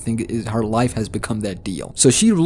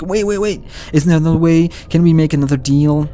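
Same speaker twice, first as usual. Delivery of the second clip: desperate plea; 6 semitones higher, 4 dB louder, faster than usual. Retold or imitated. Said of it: "And she's like".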